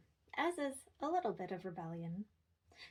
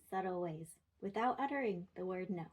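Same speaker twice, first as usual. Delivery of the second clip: usual speaking voice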